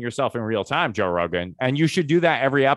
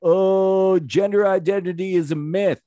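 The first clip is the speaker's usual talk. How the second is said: higher, hammed-up pundit voice pitching hot takes; mock right-wing pundit; 5 semitones higher, 4 dB louder, much slower than usual. Mocking a right-wing guest who wants a podcast spot, hammed-up high pundit voice. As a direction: play a smug culture-war guest angling for a booking. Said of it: "I can shit talk liberals".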